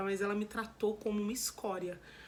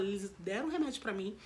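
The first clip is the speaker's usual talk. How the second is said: normal talking voice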